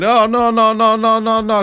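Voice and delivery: high, distressed repeated no; crying out. Retold or imitated. Retelling him seeing the car blow up, high anguished no's. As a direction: cry no over and over, mock anguish. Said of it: "And he crying like".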